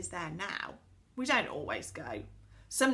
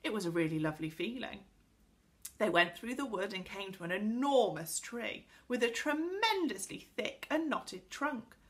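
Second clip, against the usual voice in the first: usual speaking voice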